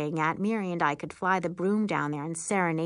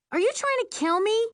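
high-pitched outraged yell; indignant yell; 4 dB louder than usual. A narrator's reading. Girl nearly falling off broom, yelling. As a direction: cry out high in alarm. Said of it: "I yell".

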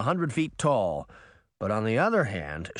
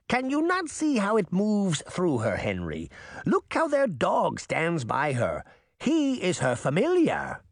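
put-on old witch insisting; 6 semitones higher than usual. A narrator's reading. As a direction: press the point like a witch. Baba Yaga arguing.

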